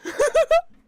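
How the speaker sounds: squealing laugh; high burst of laughter. Real, not acted. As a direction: crack up high.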